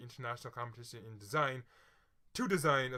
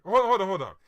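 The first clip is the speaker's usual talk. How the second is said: hold on; 7 semitones higher, 13 dB louder, faster than usual. Real, not acted. Rereading the question.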